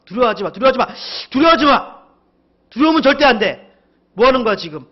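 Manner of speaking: building to a loud, high rebuke; stern command. Acted. The Lord rousing someone from fear, stern and urgent. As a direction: repeat it with mounting severity. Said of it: "이렇게 엄하게 주님께서 말씀하시는 그런 톤으로".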